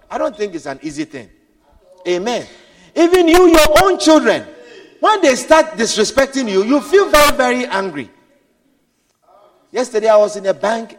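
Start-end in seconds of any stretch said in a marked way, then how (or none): 2.9-8.1 raised, loud sermon voice; loud preaching. Acted. Preacher raising his voice to the congregation. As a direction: press the point on the crowd.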